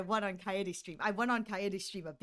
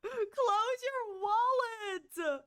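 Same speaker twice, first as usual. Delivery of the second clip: high, slow whining plea; whiny drawn-out plea; 10 semitones higher, much slower than usual. Acted. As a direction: stretch it out and whine.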